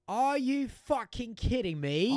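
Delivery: loud, slow, high incredulous chef; mock an outraged yell, stretching each word; Gordon Ramsay. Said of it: "sorry for yelling".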